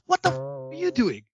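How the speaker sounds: mother catching him on the stairs, high and sharp; imitated startled mom. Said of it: "She goes".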